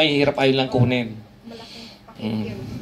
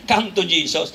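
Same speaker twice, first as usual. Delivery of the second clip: ordinary talk, nothing marked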